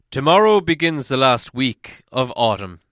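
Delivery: loud, grumbling dwarf lord; Thorin, gloomy dwarf. Acted. Thorin to the dwarves, a loud grumbling complaint. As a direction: boom out the bad news like a weary dwarf king. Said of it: "said Thorin one day".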